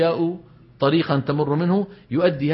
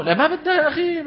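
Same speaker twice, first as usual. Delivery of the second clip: normal talking voice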